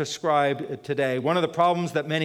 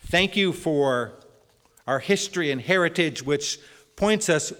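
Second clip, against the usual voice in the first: earnest, louder prayer voice; 5 dB louder than usual. Acted. Pastor praying aloud for the congregation, earnest and louder. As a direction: lift the congregation's thanks to God.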